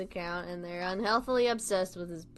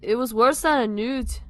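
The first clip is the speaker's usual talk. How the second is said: loud, amateur Scouse; Liverpool accent; 3 semitones higher, 6 dB louder than usual. Acted. Tom asking Simon to look, Liverpool accent. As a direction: lay on a put-on Liverpool accent. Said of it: "keep the Liverpool accent".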